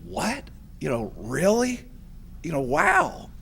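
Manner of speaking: rising, amazed What and wow; imitating his stunned first reaction; retold disbelief. Inductee retelling the call from Joni, mock stunned surprise. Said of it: "the first thing I said is like".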